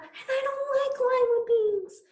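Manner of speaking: put-on childish whimpering complaint; whiny complaint. A narrator's reading. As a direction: whine it like a sulking kid.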